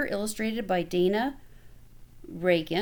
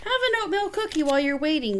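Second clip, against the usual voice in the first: quick, higher offhand offer; mother hurrying him along, voiced higher and fast; busy mom; 6 semitones higher, faster than usual. A narrator's reading. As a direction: toss it off fast like a distracted mom. Mother offers cookie distractedly.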